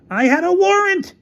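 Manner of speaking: very high, loud retort; high-pitched yelled justification; indignant shout. Acted. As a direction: shout back in your defence. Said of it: "shouted Featherstone".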